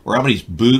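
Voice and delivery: usual speaking voice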